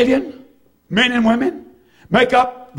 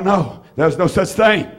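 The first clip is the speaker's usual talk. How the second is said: ordinary delivery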